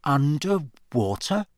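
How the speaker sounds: slow, puzzled question; slow and wondering. A narrator's reading. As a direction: work it out slowly as you speak. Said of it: "Harry said slowly".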